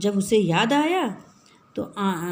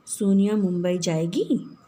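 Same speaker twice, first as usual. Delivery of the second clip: "सोनिया मुंबई जाएगी" asked with a rising tune at the end; as a question. Shown as a demonstration. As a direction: ask it, lifting "जाएगी" at the end. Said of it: "ये प्रश्न के रूप में". (प्रश्न के रूप में, "जाएगी" पर उठता स्वर)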